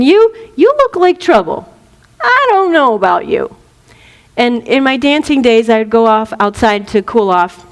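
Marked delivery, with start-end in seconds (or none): 0.0-3.6 lightly voiced suspicious bouncer, higher pitch. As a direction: size up a troublemaker at the door. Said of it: "So he can say".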